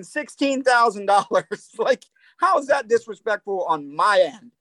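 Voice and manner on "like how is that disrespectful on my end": ordinary talk, nothing marked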